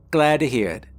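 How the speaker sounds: light Southern twang; reply in a brisk Southern drawl. A narrator's reading. Boss answers the minister, Southern drawl. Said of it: "said in a hard Southern drawl".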